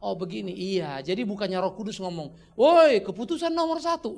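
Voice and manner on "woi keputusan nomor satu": mock call out. Mock Holy Spirit calling out.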